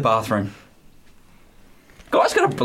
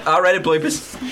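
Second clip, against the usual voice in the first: ordinary talk, nothing marked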